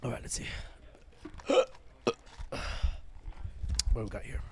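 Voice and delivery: hushed ASMR-style murmur, very soft; playful ASMR. Pretend. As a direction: whisper it low into the mic, mock ASMR. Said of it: "Little A S M R action".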